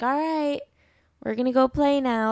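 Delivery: high, careful little announcement to a parent; coaxing child. Retold or imitated. Daughter buttering up her dad before playing, coaxing. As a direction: lift the pitch, easing gently toward leaving. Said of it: "make him feel included".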